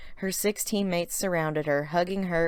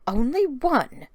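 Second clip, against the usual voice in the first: dismayed; 8 semitones higher, 3 dB louder, slower than usual. Acted. Dismayed girl character reply.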